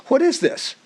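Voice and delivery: high, sharp judge's demand; stern judge. Retold or imitated. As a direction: bark it from the bench. Judge slams gavel.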